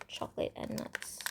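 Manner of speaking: breathy whispered repeat of the words; hushed, thoughtful whisper. Real, not acted. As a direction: drop to a whisper, musing to yourself. Repeats the pile name whispered.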